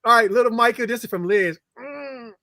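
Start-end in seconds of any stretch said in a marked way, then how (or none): 1.8-2.3 soft pretend hug. Hugging little Michael for Liz.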